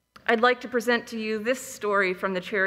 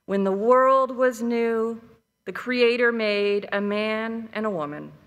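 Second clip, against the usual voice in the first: calm, measured storytelling voice; story narration. A narrator's reading. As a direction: tell it like a fireside legend. Reads a Cherokee legend aloud.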